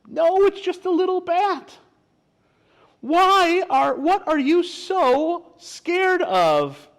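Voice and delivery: high, loud, squeaky critter voice; high-pitched storybook bat; little bat. Acted. Little bat speaks to scared animals.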